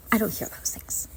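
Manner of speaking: husband's answer as a soft whisper; whispered reply. Retold or imitated. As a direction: deliver it hushed and deadpan. Husband's flat denial quoted.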